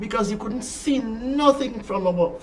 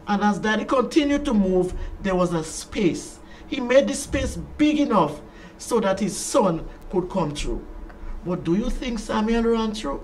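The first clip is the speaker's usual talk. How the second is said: usual speaking voice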